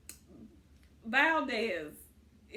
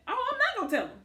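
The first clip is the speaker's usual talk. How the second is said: high-pitched retold motherly telling-off; scolding mom; 9 semitones higher, faster than usual. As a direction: voice a mom ordering her kid, pitched well up. Mother scolding son in retold scene.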